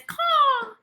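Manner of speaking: high loud bird caw; raven caw. Acted. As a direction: squawk like a raven. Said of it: "Raven says".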